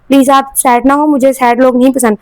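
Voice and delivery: usual speaking voice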